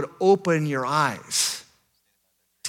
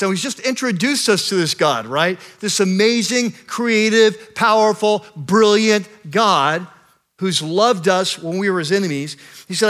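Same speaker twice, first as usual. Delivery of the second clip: louder, rising sermon build to a climax; preaching at full force; 5 semitones higher, 6 dB louder than usual. Acted. Pastor to his congregation, preaching at full force. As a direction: preach it, lifting the room. Pastor praising God's attributes.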